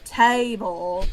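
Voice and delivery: "table" stretched into extra syllables, tay-ee-bul; multi-syllabic TABLE. Shown as a demonstration. Wife recalling her tired Southern slip, drawn-out table. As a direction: draw out the first vowel of "table". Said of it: "made it a multi-syllabic word".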